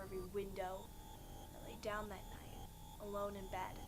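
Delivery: low, soft, sombre narration; hushed horror storytelling. Acted. Storyteller recounting the officer's news and night alone, hushed and grim. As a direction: sink into a grave hush with long pauses.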